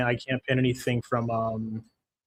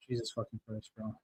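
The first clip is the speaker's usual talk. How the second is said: low, much softer muttered curse; exasperated mutter; 3 semitones lower, 14 dB quieter than usual. Real, not acted. Streamer groaning to himself after the parlay busts, muttered. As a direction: grumble it under your breath in disgust.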